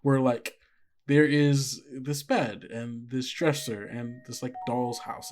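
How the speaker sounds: usual speaking voice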